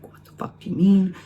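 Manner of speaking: high-pitched indignant outburst; mock pissed off. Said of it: "Victor is fucking pissed off".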